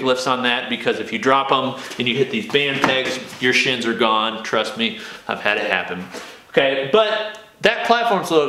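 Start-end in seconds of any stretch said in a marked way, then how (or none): none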